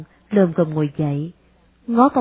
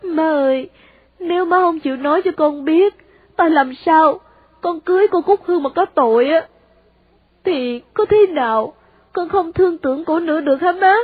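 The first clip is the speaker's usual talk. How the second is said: put-on higher, urgent young son's plea; pleading son; 9 semitones higher, 3 dB louder than usual. A narrator's reading. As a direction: press your mother for the truth. Son begs mother again.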